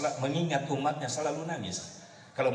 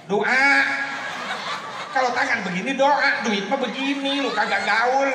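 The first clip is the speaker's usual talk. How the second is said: high, loud, teasing sermon voice; loud comic preaching; 10 semitones higher, 7 dB louder than usual. Acted. Preacher teasing the audience about donations, loudly joking. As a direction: play it to the crowd, loud and joking.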